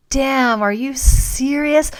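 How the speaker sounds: high, loud, slow disbelief; stunned, drawn-out are you serious; can't believe what she's hearing; shocked disbelief. Retold or imitated. Shocked at blackhead claim.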